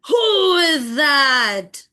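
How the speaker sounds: very slow, loud lion roar; drawn-out furious shout; angry lion. Acted. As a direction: bellow it like a lion woken up, stretching every word. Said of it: "and in anger he said".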